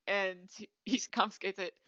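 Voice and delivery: usual speaking voice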